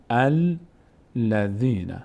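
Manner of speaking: الذین very slowly, split after the first syllable; syllable-by-syllable demonstration. Shown as a demonstration. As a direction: sound out الذین piece by piece.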